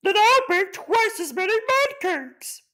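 very high, loud snorty boast; snorting warthog. Acted. The warthog outbids the macaw, very high and snorty. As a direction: snort it out defiantly. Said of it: "snorted the warthog".

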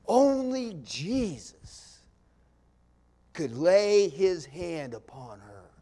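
loud, rising preacher's fervor; passionate plea. Retold or imitated. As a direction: pour all your passion into it. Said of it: "But can you hear the passion?".

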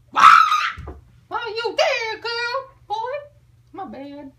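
put-on very high squeaky girl voice, laughing shriek; squealing girl. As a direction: squeal like a startled schoolgirl. Girls' reaction, squealed quote.

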